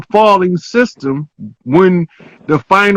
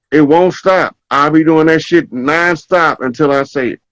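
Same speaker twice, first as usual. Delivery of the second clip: usual speaking voice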